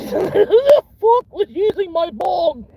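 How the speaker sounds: very high, loud, slow squeal of outrage; mock outraged British guy. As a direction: shriek it like a furious Brit. UK stoner's bong broken.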